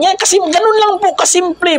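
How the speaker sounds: high, emphatic preacher's voice; impassioned preaching. Real, not acted. Preacher tells the flock it is simple, impassioned preaching. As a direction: lift the voice high to insist.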